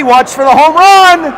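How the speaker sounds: building to high, loud calls; excited play-by-play. Real, not acted. Announcer watching for the home run pass, excited. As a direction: urge the play on with rising volume. Live call of a breakaway.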